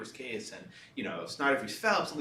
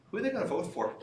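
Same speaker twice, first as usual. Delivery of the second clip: laughing; 7 semitones higher, 4 dB quieter, faster than usual. Retold or imitated. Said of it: "He said".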